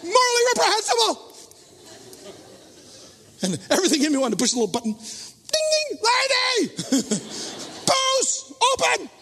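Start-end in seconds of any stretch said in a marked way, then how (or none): 0.0-1.2 high, prim and scandalized; mock indignation. Preacher mocks his own judgment of the drinker, prim outrage. 4.9-9.1 mock tattletale call. Imagined call to the flight attendant, shrill tattling.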